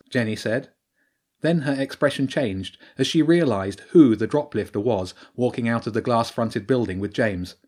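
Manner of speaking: usual speaking voice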